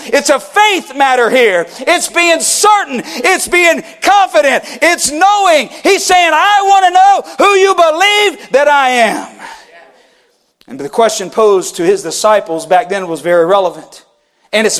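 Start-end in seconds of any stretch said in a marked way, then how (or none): 1.8-9.3 fervent preacher, raised and driving; preaching at full force. Acted. Pastor pressing the church with a fervent call. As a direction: press the question on the congregation.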